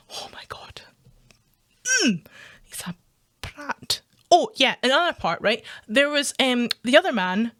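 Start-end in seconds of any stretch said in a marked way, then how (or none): 0.1-4.0 barely audible, whispered exclamation; exasperated whisper. Real, not acted. Exasperated by the man's remarks, whispered to self. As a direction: whisper it under the breath. Exasperated aside after mimicking him.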